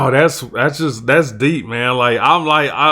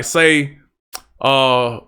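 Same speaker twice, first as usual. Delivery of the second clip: usual speaking voice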